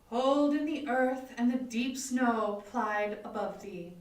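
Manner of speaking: usual speaking voice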